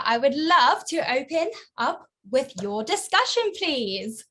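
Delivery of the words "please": ordinary talk, nothing marked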